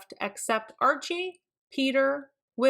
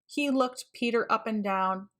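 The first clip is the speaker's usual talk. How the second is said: ordinary delivery, nothing marked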